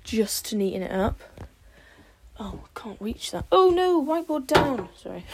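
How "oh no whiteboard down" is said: high loud oh no; startled loud yelp; alarmed shout. Real, not acted. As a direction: cry out as it falls. Whiteboard falls.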